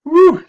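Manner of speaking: loud, high-pitched whoop; high whooped "woo". Retold or imitated. Teacher recalling an angry caller, whooping. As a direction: let out a big "woo!" of mock alarm. Reacting to remembered cussing out.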